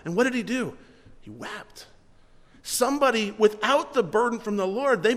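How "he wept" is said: much softer, dropped low and hushed. Acted. Pastor telling the congregation how Nehemiah wept, hushed and low. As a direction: let it fall away to almost nothing.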